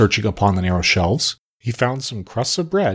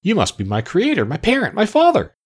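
rising amazement, higher with each phrase; surprised; 10 semitones higher than usual. A narrator's reading. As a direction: build to an astonished, high-pitched peak. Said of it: "in a tone that expressed surprise".

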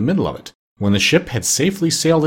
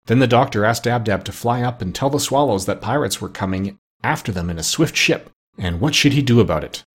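usual speaking voice

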